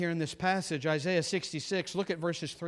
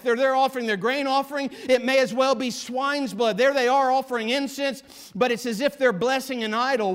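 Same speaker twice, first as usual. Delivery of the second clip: louder, higher fervent preaching; full-force preaching; 6 semitones higher, 7 dB louder than usual. Acted. Preacher condemning professing worshipers, loud and fervent. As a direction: drive it home like a revival preacher.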